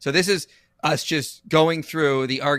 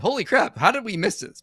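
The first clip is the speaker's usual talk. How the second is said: astonished; 10 semitones higher, 4 dB louder than usual. Retold or imitated.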